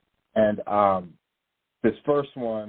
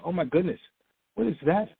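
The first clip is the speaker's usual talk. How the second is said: higher, rising in mock amazement; mock astonished gasp; 7 semitones higher, faster than usual. Preacher mimicking unbelievers staring at the sky, mock amazed. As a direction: play the unbelievers gaping at the sky. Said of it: "and they go".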